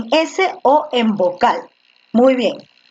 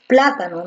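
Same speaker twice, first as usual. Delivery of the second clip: plátano with the first syllable PLA stressed; plátano said PLA-ta-no, first syllable pushed; 6 semitones lower than usual. Shown as a demonstration. Teacher confirming the right stress: plátano as PLA-ta-no. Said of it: "Tú dices plátano".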